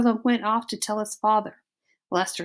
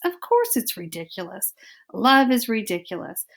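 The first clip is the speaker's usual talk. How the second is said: usual speaking voice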